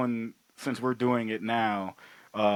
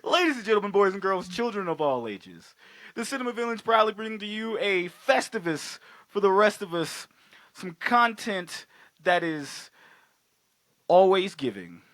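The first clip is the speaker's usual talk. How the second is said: ringmaster announcer; 5 semitones higher than usual. Acted. Showman announcement opening the show.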